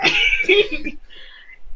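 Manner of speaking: short breathy nervous giggle; nervous laugh. Real, not acted. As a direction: laugh it off awkwardly. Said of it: "That's a nervous laugh".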